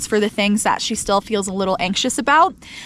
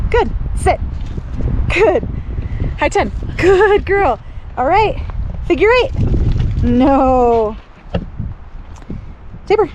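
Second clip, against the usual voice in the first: dog commands; 5 semitones higher than usual. Real, not acted. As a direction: call out each trick to the dog. Giving dog commands in turn.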